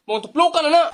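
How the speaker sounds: ordinary talk, nothing marked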